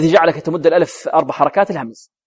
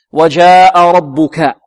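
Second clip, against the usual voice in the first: slow, held-out vowels, louder than talk; measured recitation; 6 dB louder, much slower than usual. Shown as a demonstration. Teacher reciting the verse for students, measured recitation. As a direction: recite it slowly for students to copy.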